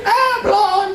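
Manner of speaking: high, fast scoffing dismissal; hammed-up scoff; mocking scoff. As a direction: brush it off with a high sneer.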